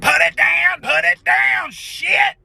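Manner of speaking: very high, frantic hissed warning; urgent panicky pleading; panicked dad. Retold or imitated. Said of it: "My dad's like".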